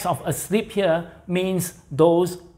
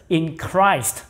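ordinary talk, nothing marked

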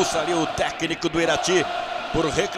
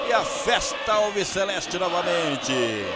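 goal call; 3 semitones higher than usual. Real, not acted. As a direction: explode on the goal. Third goal called.

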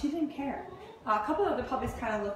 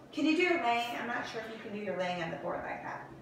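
ordinary delivery

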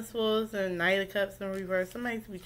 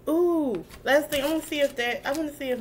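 excited; 8 semitones higher, 6 dB louder than usual. Real, not acted. Sudden thrill at the last question.

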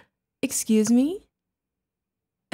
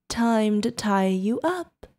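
ordinary talk

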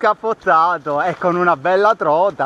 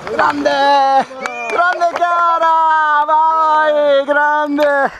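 cheering; 9 semitones higher, 5 dB louder, much slower than usual. Real, not acted. Cheering friend's catch.

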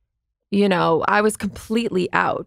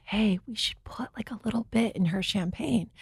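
hushed scheming whisper, much softer; conspiratorial whisper; 9 dB quieter than usual. Retold or imitated. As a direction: whisper it like a sneaky plan between guys. Guys plotting, retold conspiratorial quote.

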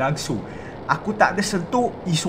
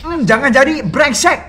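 scolding; 6 semitones higher, 8 dB louder than usual. Real, not acted. Scolding game character.

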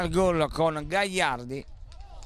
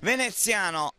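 breathless italian goal commentary; 7 semitones higher than usual. Real, not acted. Goal scored, commentator erupts.

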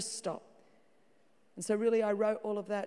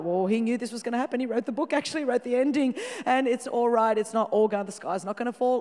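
usual speaking voice

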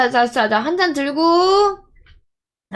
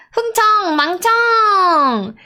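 toast call out; 6 semitones higher, slower than usual. Acted. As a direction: call it out slowly to the room. Calling out a toast.

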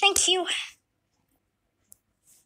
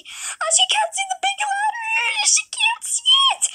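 much higher, louder cry of alarm; panicked shout; 6 dB louder than usual. Acted. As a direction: shriek in shock that she can't see. Mother panics at diagnosis.

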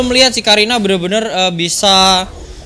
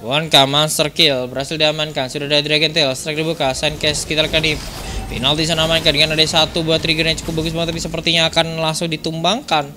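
esports play-by-play; 3 semitones lower, 3 dB quieter than usual. Acted. Live esports play-by-play casting.

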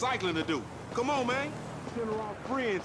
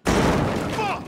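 angry curse; 12 semitones higher, 7 dB louder than usual. Acted. Ambushed in a shootout.

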